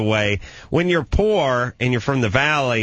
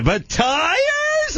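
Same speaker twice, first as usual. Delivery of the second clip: much higher incredulous squeak; high disbelief. Retold or imitated. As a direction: let it squeak up in shock. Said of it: "my voice went up like twenty-eight octaves".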